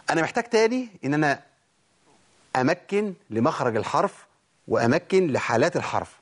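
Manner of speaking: ordinary delivery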